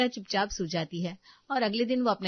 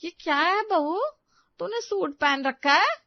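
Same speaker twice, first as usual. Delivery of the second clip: loud, higher-pitched scandalized question; shocked disapproval; 10 semitones higher, 7 dB louder than usual. Acted. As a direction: demand an explanation, voice raised in shock. Mother-in-law shocked by the suit.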